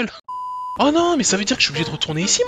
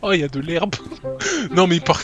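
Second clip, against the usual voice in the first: ordinary talk, nothing marked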